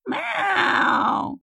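high, drawn-out meow; panicked cat yowl. A narrator's reading. Cat flung across the room, wailing in panic. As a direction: yowl like a cat sent flying. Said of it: "в панике голося".

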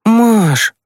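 put-on high boy's voice, mildly put out; wincing protest. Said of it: "поморщился Тимкин". (недовольно, высоким мальчишеским голосом)

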